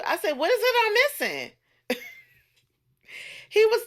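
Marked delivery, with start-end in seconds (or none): 0.3-1.5 high-pitched incredulous comeback; mock incredulous. Woman retelling her comeback to a bar flirt, incredulous. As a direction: toss it back high, in mock disbelief.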